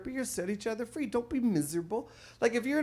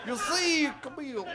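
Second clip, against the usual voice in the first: Bill Cosby impression; 5 semitones higher than usual. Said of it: "my Bill Cosby impression".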